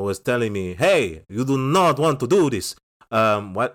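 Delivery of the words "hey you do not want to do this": mock Italian drifting into Transylvanian vampire, higher and louder; a hammy Dracula-ish Italian warning; Italian gone vampire. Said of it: "my Italian went a little bit Transylvanian vampire".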